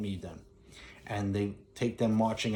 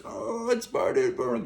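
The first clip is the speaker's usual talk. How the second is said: wife's mock burning cry; 11 semitones higher than usual. Wife runs out clutching her mouth, very high mock wail. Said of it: "wife comes running out like this".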